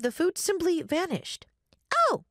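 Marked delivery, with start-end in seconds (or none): 2.1-2.2 put-on sudden little-girl "oh"; child ghost exclaiming. A narrator's reading. Child ghost remembering more food, exclaiming.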